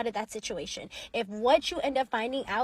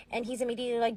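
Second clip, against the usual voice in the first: usual speaking voice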